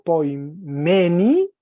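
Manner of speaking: poimeni slowed, "me" hit with force; exaggerated stress on ME. Shown as a demonstration. Teacher correcting the dative's pronunciation, heavy stress on "me" in poimeni. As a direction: punch and stretch "me" in poimeni. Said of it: "Estoy señalando, recargando como el acento".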